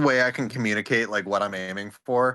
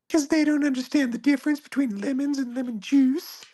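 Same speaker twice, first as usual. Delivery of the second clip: very high-pitched cartoon character; tiny high character voice; Bitsy voice. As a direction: put on a high squeaky little voice. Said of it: "a second to do Bitsy".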